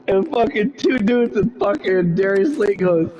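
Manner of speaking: ordinary delivery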